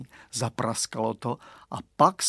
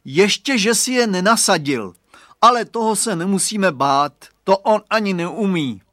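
high, loud baiting remark; loud, for overhearing; 6 semitones higher, 7 dB louder than usual. A narrator's reading. Boy baiting the bully within earshot, loud. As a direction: project it so the rival overhears. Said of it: "pak řekl hlasitě, aby to Hugo slyšel".

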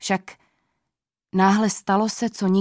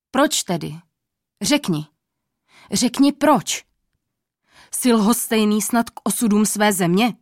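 angry king. A narrator's reading. King demands the old man explain his silence, angry. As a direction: push the old man hard for an answer. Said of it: "A hlas měl hněvivý".

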